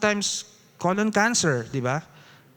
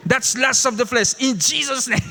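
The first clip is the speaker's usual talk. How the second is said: mock rebuke; 6 semitones higher than usual. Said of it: "Sasabihin sa'yo next".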